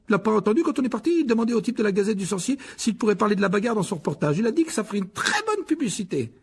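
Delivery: high-pitched, put-on youngster's voice; excited boy. A narrator's reading. Fred tells his family about Lockhart, eagerly. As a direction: report the good news in a high, eager rush.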